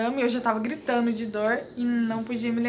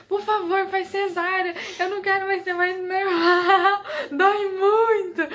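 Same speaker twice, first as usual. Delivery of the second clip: high re-enacted sobbing plea; tearful begging; 7 semitones higher, 3 dB louder, slower than usual. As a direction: act out crying and pleading, building louder and slower. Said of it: "gente, eu tava chorando". (imitando choro e súplica, agudo e arrastado)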